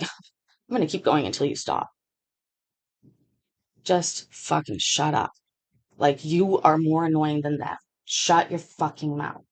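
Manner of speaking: low-key threat, slowed down; quiet menacing. Retold or imitated. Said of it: "I was like".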